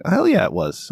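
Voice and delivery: high, excited hell yeah; mock eager reply. Quoting his own eager answer to a friend, high and excited. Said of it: "And I'm like".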